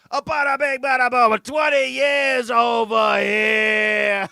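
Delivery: put-on high, booming New York accent, last words drawn out; Donald Trump. Host imitating the president back home, hammed-up New York accent. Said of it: "like, gets his New York accent back".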